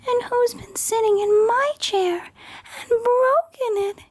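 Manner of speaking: very high, tiny cub voice; teeny weeny Baby Bear voice. Acted. Baby Bear wailing that his porridge is gone, very high and tiny. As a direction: squeak it out like the smallest bear. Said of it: "yelled teeny weeny Baby Bear in his teeny weeny voice".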